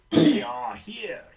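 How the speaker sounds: loud, slow, drawn-out warning; ominous announcement. A narrator's reading. Gadd turning to the jungle, ominous drawn-out warning. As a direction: draw it out, high and dramatic. Ominous line in story reading.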